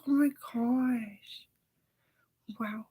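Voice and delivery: slow whispered oh my gosh; awed whisper. Retold or imitated. Her awed reaction in a slow whisper. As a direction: breathe it in awe. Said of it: "And she's like".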